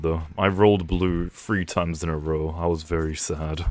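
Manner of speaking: normal talking voice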